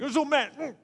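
high, loud mock tantrum; cute, hammed-up child's anger; mad little boy. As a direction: imitate a chubby little kid being cross. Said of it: "when he's mad".

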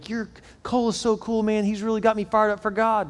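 high-pitched imitation of a young fan; enthused young admirer. Young people praising the pastor's son, imitated high voice.

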